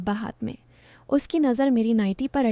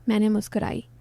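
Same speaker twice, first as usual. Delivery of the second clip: usual speaking voice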